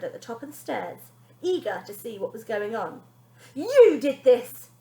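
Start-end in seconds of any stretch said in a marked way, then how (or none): 3.5-4.7 slow, loud accusing roar; ogre-like demand. Acted. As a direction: bellow the blame at them. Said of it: "Bonnie turned to them and demanded".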